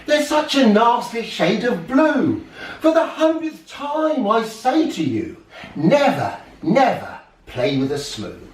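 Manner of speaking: hammed-up scolding with a laugh; angry grandfather. A narrator's reading. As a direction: grandfather shaking his fist, mildly angry. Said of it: "as he angrily said".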